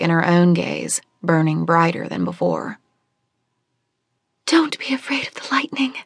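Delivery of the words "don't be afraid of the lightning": high, urgent whisper; breathless secret warning. Acted. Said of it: "she whispered in an urgent voice".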